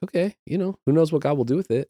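mildly skeptical, high-pitched recollection of doubt. Retold or imitated. Speaker recalls walking into the campaign unsure, skeptical. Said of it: "I was very skeptical about it".

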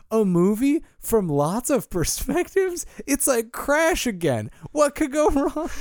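naively thrilled, pitch climbing high; excited. Retold or imitated. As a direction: gush like an over-optimistic moviegoer. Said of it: "I was really excited about it".

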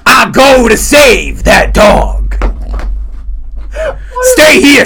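loud, high, stretched-out declamation; hammy movie-hero voice. Acted. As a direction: bellow it like an overblown film star. Acts Pacino heroics for dog.

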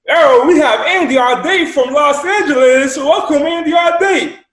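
high, loud, slow announcer voice, drawn out; imitating the host booming out his name; hammed-up call host. The call host greeting Andy on the group call, hammed-up high booming announcer.

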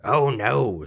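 gleeful little man. A narrator's reading. Little man rejecting guesses, gleeful. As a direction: turn it down with relish.

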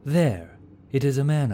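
high, loud sudden call; sudden cry. A narrator's reading. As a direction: shout it out as you spot him. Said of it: "cried Vantamir suddenly".

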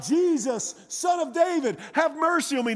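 high-pitched pleading call; acted beggar's cry for mercy; retold yell. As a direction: call out to someone you cannot see. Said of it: "began to yell out".

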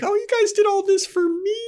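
squeaky high touched voice; high mock gush. Buffy retold, gushing in a high put-on voice. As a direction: gush way up high, faking delight.

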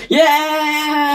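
high, drawn-out celebratory yeah; excited cheer. Real, not acted. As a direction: cheer it high, like a real fan. Cheering the character's breakthrough.

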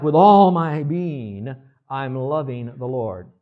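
slow, loud swell on "With all my being"; fervent declaration. Retold or imitated. Preacher voicing a child's wholehearted love, fervent and slow. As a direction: pour out devotion with all your being. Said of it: "so it's kinda like".

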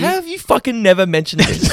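high-pitched, stunned reproach; real incredulous outrage. Real, not acted. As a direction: demand it in real disbelief, pitch leaping up. Stunned co-host never told before.

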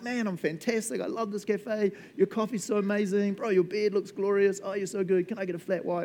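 high, effusive praise voice; gushing customer. Retold or imitated. Friendly customer gushing to the barista, high voice. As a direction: gush over the cafe. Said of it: "they're like".

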